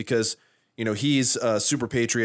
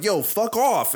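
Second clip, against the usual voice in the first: a higher, mocking brush-off; dismissive brush-off; 10 semitones higher, slower than usual. Friend imitating Sam telling off the cops, higher mock dismissal. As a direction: flick it away, higher and cocky. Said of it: "and Sam's like".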